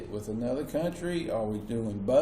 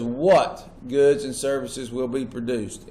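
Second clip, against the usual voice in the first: What set apart and punched, the rest slower; stress on What; 4 dB louder than usual. Shown as a demonstration. Teacher dictating the first economic question, stressing What. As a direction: lean hard on What, then read on slowly. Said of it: "Emphasis on the word what".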